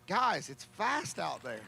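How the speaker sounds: lightly borrowed announcer catchphrase; famous announcer line. Retold or imitated. Track announcer borrowing a famous announcer's line. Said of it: "not to take a line from a famous, uh, announcer".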